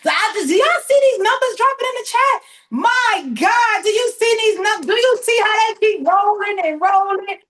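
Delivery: high, loud celebratory holler; overwhelmed singsong shout of amazement; exuberant shouting. Real, not acted. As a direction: belt it out, stretching each rolling. Marvels at numbers in the chat.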